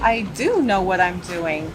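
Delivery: slow, insistent stress on "do"; defensive, slowed down with "do" punched. Acted. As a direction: lean hard on "do", drawing it out. Actress defends herself against director.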